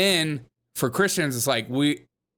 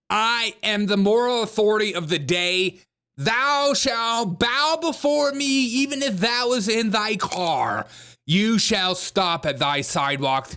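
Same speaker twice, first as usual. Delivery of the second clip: slow, high mock-biblical decree; dark lord; 8 semitones higher than usual. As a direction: proclaim it like a self-made deity. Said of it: "was like".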